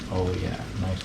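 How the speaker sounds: ordinary delivery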